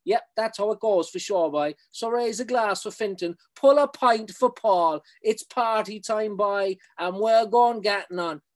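high, lively Cork banter; strong Cork accent. Acted. Poet cheering the two rowers in a strong Cork accent. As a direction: keep the Cork lad going between the verses. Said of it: "it's in a very strong Cork accent".

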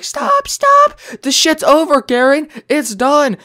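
high, loud shrieking Karen imitation; mocking her screaming. Mocking the woman yelling at the kids. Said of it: "and you're like".

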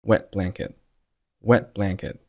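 "Wet blanket" with both t sounds held back; unreleased T. Shown as a demonstration. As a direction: swallow the t ending "Wet" and "blanket". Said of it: "也可以不用爆出来".

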